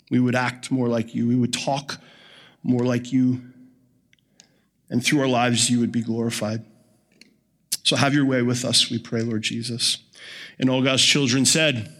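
reverent closing prayer before the sermon. Acted. Pastor's opening prayer.

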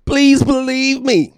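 loud, high mock plea, slow at the end; mocking government voice. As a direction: beg them to comply like a fake authority. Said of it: "just say".

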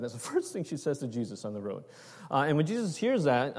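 normal talking voice

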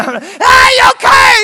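high, loud worried call; urgent call. Retold or imitated. Pastor calls to the girl he just pulled from the water, loud and worried. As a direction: call out to check she's alright.

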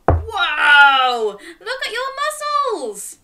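high, delighted whoa; amazed praise. Real, not acted. Storyteller cheering a child's muscles, thrilled. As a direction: marvel at the muscles.

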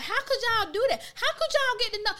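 high-pitched, exaggerated questioning; mock bafflement. Asking how friends get changed so fast, high mock bafflement. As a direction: push the pitch way up, incredulous.

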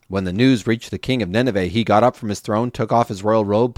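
plain reading voice

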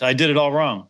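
ordinary delivery

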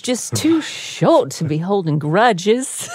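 slow, hammed-up Southern belle; Blanche Devereaux. Hamming up O J's quote for laughs, slow Southern drawl. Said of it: "your impression of Blanche Devereaux and O J are the same".

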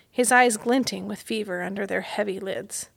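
ordinary delivery

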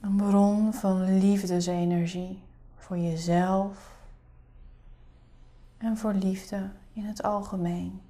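soft spa whisper. Acted. Leads a guided meditation.

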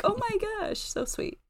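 high, soft coo fading to whisper; hushed gushing. Retold or imitated. Parent recalling sweet home video, hushed and gushing. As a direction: coo softly, trailing into a whisper.

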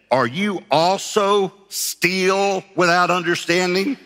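slow, high-pitched, drawn-out question of disbelief; harsh incredulous rebuke. Acted. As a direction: stretch out each word as a mock scolding. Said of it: "Jesus didn't say it like that".

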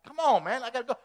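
an impatient rider begging his stalled donkey; exasperated prophet. Retold or imitated. Prophet urging his stalled donkey, exasperated and pleading. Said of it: "He's like".

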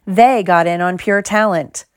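ordinary delivery

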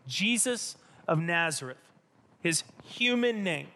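usual speaking voice

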